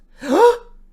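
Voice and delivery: loud high gasp of shock; a sudden excited gasp at the news; sharp gasp. Real, not acted. Sees Elon's Twitter poll.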